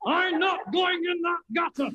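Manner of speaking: high, slow shouting fish voice. Acted. Puppet fish refusing the chip shop batter. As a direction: squawk it out as a stubborn fish.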